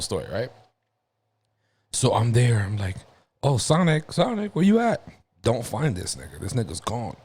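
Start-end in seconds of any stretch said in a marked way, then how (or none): 3.4-5.0 high, lilting search call; calling out for a pet. Retold or imitated. Boy hunting for his gerbil at hide-and-seek, calling out. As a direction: sing out the name as if looking for it. Child calls for lost gerbil.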